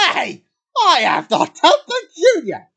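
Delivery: high, loud outraged protest; indignant shout. Acted. Boy teased by his dad, indignant high shout. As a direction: yell back at the insult.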